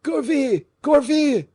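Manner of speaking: very high, loud calling out; frantic cry. A narrator's reading. Fouquet calling his servant Gourville, frantic loud cry. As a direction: call the name in panic. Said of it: "cried he".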